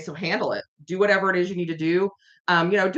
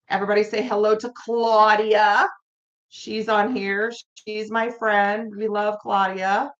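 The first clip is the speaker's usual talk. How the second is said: put-on Long Island accent; 3 semitones higher than usual. Introducing the moderator and a friend, in a Long Island accent. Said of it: "start talking in Long Island accents".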